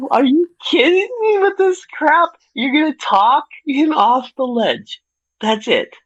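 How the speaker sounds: outraged at the lazy ending; incredulous rant. Real, not acted.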